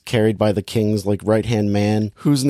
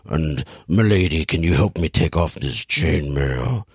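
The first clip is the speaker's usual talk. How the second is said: Russell Crowe mumble; 7 semitones lower than usual. Retold or imitated. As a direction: mumble it low and gravelly, barely audible. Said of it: "Everything he says is mumbled and, and gravelly".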